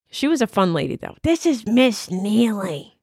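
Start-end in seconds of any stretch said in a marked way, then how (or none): 1.2-2.9 slow, odd put-on voice of the teacher; Miss Neely's voice. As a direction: imitate the old teacher's slow, halting voice. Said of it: "That's how she sounded".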